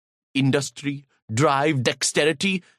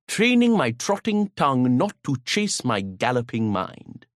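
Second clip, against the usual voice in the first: ordinary delivery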